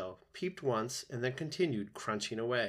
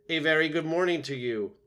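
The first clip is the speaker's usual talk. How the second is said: louder, higher cheery greeting; friendly, booming; 6 semitones higher, 7 dB louder than usual. A narrator's reading. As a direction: boom a friendly hello across the water. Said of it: "friendly voice boomed over the water".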